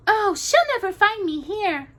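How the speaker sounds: high bright princess voice. A narrator's reading. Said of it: "she said brightly".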